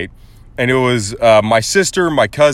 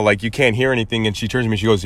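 ordinary delivery

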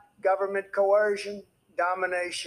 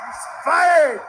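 fired shouted much higher and louder; mock furious yell; 8 semitones higher, 9 dB louder than usual. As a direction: bellow it like an angry boss firing someone. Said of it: "to say".

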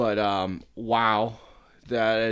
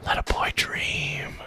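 wistful whisper; slower than usual. Pretend. Wistful aside.

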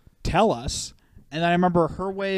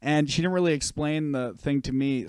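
ordinary talk

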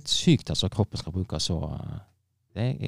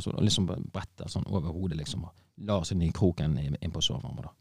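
ordinary delivery

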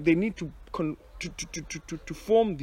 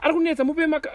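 high mimicked parent; 6 dB louder, much faster than usual.

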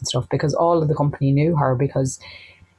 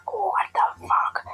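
stunned whisper; 4 dB quieter than usual. Retold or imitated. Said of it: "I was fuming".

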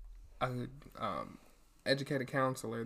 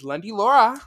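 announcer; 9 semitones higher, 16 dB louder than usual. Acted. Intro name announce.

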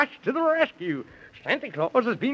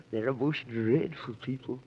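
much lower and softer, gasping in fear; hushed dread; 9 semitones lower, 7 dB quieter than usual. Acted. Man in the Moon warns of the dreadful Squibboblins, hushed dread. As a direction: shrink back in mock terror. Gasp then dread of villains.

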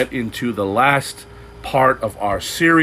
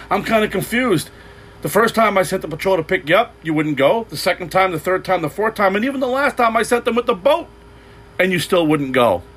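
higher, bemused voice listing each rescue; puzzled God; 7 semitones higher than usual. Retold or imitated. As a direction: sound puzzled, spell out the obvious. God answering the couple.

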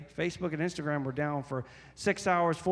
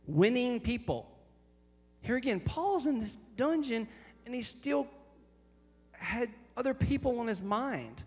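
high-pitched, driving sermon delivery; impassioned preaching; 9 semitones higher, 3 dB louder than usual. Real, not acted. Preacher telling the congregation of Paul's care for others, impassioned.

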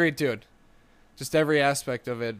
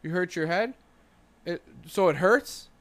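grandpa's mock concern; 4 semitones higher than usual. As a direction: ask like a grandpa checking a hurt kid. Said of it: "He'd come up and go".

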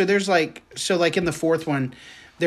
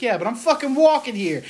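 higher-pitched mock Boston accent; Boston accent, imitated; 8 semitones higher than usual. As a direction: play an indignant Boston pedestrian. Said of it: "a guy walking in Boston".

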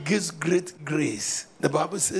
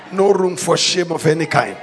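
preaching at full force; 2 semitones higher, 10 dB louder than usual. Acted. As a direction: thunder it over the congregation.

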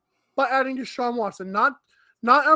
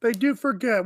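usual speaking voice

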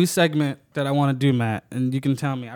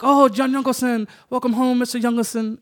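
higher, imitating Japanese hosts greeting; Japanese accent; 8 semitones higher than usual. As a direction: welcome the guest like a Japanese host. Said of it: "how they would pronounce it".

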